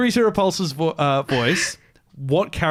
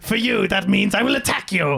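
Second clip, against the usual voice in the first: mock villain; 4 semitones higher than usual. Jokey villain voice continuing bit.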